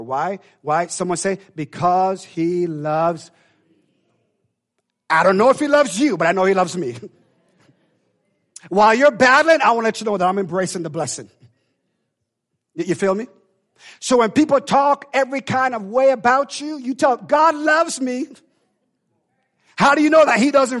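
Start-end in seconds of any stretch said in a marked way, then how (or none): 1.5-3.3 slow, drawn-out because he loves; drawn-out answer. Acted. Congregation response prompted. 17.2-18.3 slow, high, loud declaration; slow proclaiming. Acted. Preacher modelling the confession.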